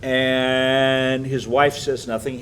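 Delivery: ordinary talk, nothing marked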